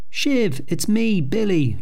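calling out. Acted. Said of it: "she cried".

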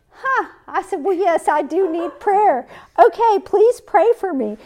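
high-pitched mock whine, close to laughing; self-pitying whine. Woman accepting prayer from her older friend, hammed-up high whine. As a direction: exaggerate your own pitiful voice, loud and high. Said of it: "I was whining to her".

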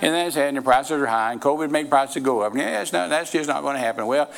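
low, subdued doom-and-gloom grumbling; mock pessimist, pitched well down; naysaying church member. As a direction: imitate a defeatist listing every excuse. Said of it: "Don't be going around saying".

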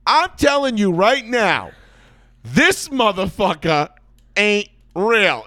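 loud, high, drawn-out mock yell; mock shout. Passenger yelling to the cabin about a player, hammed-up yell.

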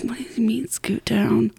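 hushed, baffled whisper; confused inner voice, barely voiced; whispered thought. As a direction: whisper it to yourself, puzzled.

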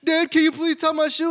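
put-on high, loud little-kid voice asking dad; pleading child. Son Theo begging dad to tie his shoe, high child voice. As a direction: beg like a small boy needing his shoe tied. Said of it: "Theo's like".

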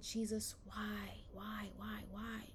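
whispered, pleading why; whispered plea. Retold or imitated. Preacher reliving a prayer over a sick friend, whispered plea. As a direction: breathe the question to God. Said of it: "I said".

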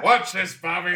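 put-on loud high drunken shout; booming tipsy uncle. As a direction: holler like a tipsy uncle showing off. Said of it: "my drunk uncle".

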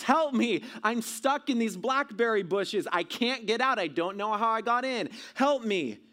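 loud, high-pitched cry for rescue; shouting for help. Retold or imitated. Trapped boy calling out from blackberry bushes, shouting for help. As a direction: call out urgently as if trapped, acting it out. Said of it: "shouting at the top of my lungs".

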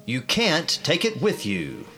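ordinary talk

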